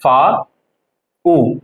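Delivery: फा, pause, ऊ; sound by sound. Shown as a demonstration. Teacher showing German V, फा and ऊ spaced apart. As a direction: break फाउ into फा and ऊ. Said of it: "इसको बोलते हैं फाउ".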